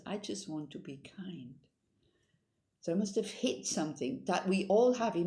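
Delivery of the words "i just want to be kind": soft quote. Retold or imitated. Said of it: "and then he says".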